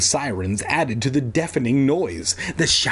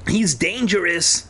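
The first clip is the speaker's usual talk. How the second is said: mild raised-pitch warning cry; shouted warning; 6 semitones higher than usual. A narrator's reading. Conan warning the crowd about Evan, mild shout. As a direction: call out a warning, lightly raised. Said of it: "Evan heard Conan shout".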